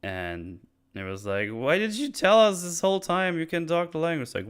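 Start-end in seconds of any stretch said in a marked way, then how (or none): 1.5-4.3 higher mock disbelief; mock incredulous. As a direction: ask it in exaggerated disbelief. Crew questioning Drax, retold.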